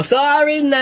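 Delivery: high, stretched-out sorry; teasing apology. Real, not acted. Prankster apologizes to crying partner, teasing. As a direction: drag it out in a rising cajoling tone.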